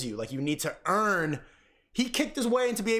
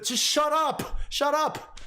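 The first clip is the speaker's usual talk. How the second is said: higher, slow irritated shut up; mock impatient; 9 semitones higher, much slower than usual. Host cutting off a rambling caller, mock impatience. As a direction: cut the caller off, exasperated.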